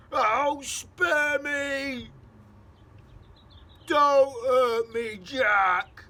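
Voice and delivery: slow, loud, high desperate begging; pleading giant. Acted. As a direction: beg for mercy.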